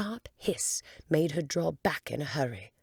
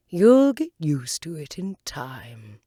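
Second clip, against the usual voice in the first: slow, louder caterpillar voice; 9 dB louder, slower than usual. Acted. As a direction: say it slowly and unbothered.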